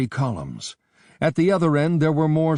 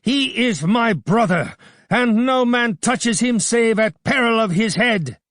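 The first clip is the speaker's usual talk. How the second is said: put-on young warrior defying the mob; roar; 10 semitones higher, 4 dB louder than usual. A narrator's reading. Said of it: "roared Bardilis".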